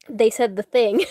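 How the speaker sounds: ordinary talk, nothing marked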